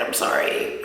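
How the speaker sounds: soft whisper, full of regret; real sorrow in a whisper; whispered apology. Real, not acted. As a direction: breathe it out as a whisper. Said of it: "I am getting emotional".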